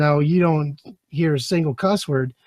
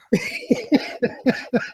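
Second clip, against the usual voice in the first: high laugh; 4 dB quieter than usual. Real, not acted. Laughing at the Fathead story.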